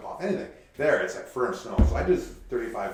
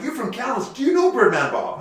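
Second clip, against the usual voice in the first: excited bar patron; 13 semitones higher, 7 dB louder than usual. Retold or imitated. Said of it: "And they're like".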